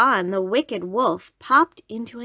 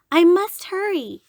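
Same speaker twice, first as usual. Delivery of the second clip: put-on little girl voice; 10 semitones higher, 6 dB louder than usual.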